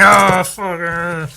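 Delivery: loud mock scream; screaming face. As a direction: yell like the face jumping out. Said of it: "and then it's screaming".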